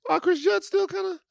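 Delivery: high-pitched amazed reaction; react, surprised he still looks good. Real, not acted. Reacting to a photo.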